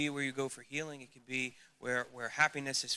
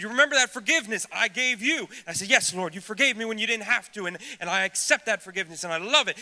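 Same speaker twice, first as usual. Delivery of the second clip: high, loud, urgent preaching; impassioned preaching; 7 semitones higher, 13 dB louder, faster than usual. Acted. Preacher acts out talk with God, loud urgent preaching. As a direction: lift the voice and drive it home.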